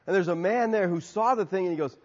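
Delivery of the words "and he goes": ordinary talk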